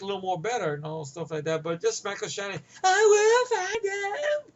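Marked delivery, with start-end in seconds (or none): 2.8-4.5 high, slow, bellowed threat; over-the-top yell. Retold or imitated. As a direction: drag it out in a hammy yell. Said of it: "See, over the top".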